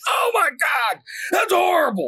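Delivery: high-pitched exasperated coach yell; hammed-up, appalled shouting; Chris Farley on steroids. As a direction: imitate a fired-up coach losing it. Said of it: "it's like Chris Farley on steroids".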